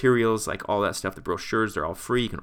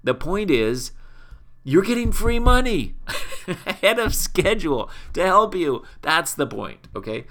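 exasperated explaining; 6 semitones higher, 5 dB louder than usual. Retold or imitated. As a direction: voice jumps high and loud on free money, laughing through it.

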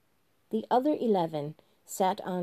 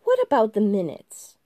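January, insistent; 3 semitones higher, 9 dB louder than usual. Acted. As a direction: hammer the point home again. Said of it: "repeated January".

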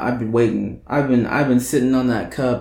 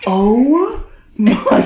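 surprised; 9 semitones higher, 4 dB louder, much slower than usual. Real, not acted. Reacting to gagging frog.

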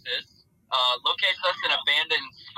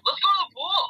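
mock excited call. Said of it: "some jackass was like".

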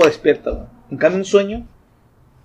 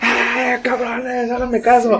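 mock yelling for help; 6 semitones higher, 3 dB quieter, much slower than usual. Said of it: "según yo en mi sueño estoy gritando".

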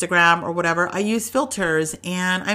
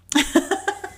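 high burst of laughter; 10 semitones higher than usual. Real, not acted. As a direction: laugh at the punchline. Laughs at TikTok punchline.

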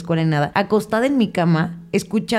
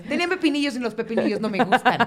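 mock excuse; 5 semitones higher than usual.

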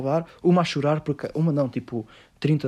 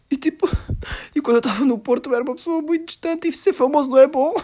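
hammed-up crying in a much higher voice; mock sobbing juror; 10 semitones higher, 3 dB louder than usual. Mocking the crying juror on the TV show, falsetto sobbing. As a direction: parody her tearful speech, voice cracking high. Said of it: "ela soluçava a chorar".

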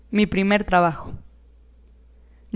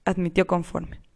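plain reading voice

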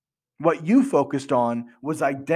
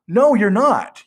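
a sudden loud, higher retort; firm loud correction of a false hope; emphatic loud no; 6 semitones higher, 6 dB louder, slower than usual. Real, not acted. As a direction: shoot the idea down hard. Contradicting the hopeful client.

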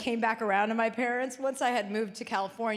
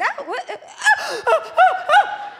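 hammed-up startled gasps, rising higher and louder; mock startled wake-up; 9 dB louder, slower than usual. Pretending to wake up next to the husband, startled. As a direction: play it up like being jolted awake, much higher and louder. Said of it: "I was like".

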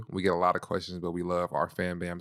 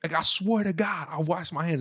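high, louder exasperated yell; retold yell; 10 semitones higher, 4 dB louder than usual. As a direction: shout it like you're losing it in an argument. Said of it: "I'm yelling".

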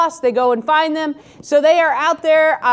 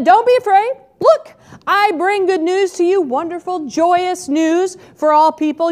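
higher and louder, a ringing announcement; angel's proclamation; 6 semitones higher, 4 dB louder than usual. Acted. Angel announces good news to the shepherds, ringing higher and louder. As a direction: proclaim it like a heavenly herald.

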